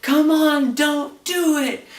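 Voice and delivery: high, drawn-out pleading shout; imitated desperate yelling. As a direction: beg them to stop at a yell. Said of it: "Walt is still yelling".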